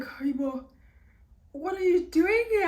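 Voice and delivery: loud, high startled cry; hayibo, sudden surprised shout; shocked. Retold or imitated. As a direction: blurt it out in shock. Ex shocked to see her.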